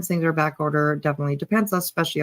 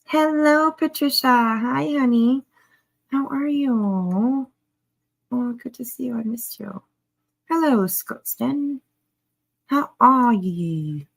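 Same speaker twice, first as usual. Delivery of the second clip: a clumsy attempt at a Scottish accent, higher and drawn out; bad Scottish accent; 4 semitones higher, slower than usual. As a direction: greet the chat in a wobbly Scottish accent. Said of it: "That was horrible".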